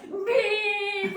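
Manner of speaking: high, long mimicked horn beep; honking back. Honking right back at her, long high beep. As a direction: stretch "beep" into a blaring high honk. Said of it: "And I went".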